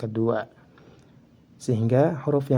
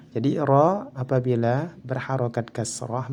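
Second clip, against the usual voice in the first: ordinary talk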